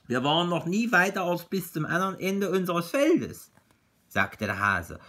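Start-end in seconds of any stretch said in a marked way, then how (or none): 0.1-3.5 higher-pitched storybook hare. Acted. Hare telling Bear and Tiger about his field, voiced hare.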